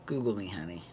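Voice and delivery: mock-unimpressed Bethany; a hint of Bethany brushing her off; sassy Bethany. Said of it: "Bethany was like".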